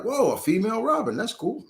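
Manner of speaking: high, quick delighted surprise, recreated; impressed surprise. Retold or imitated. Recalling a first look at the female Robin, impressed surprise.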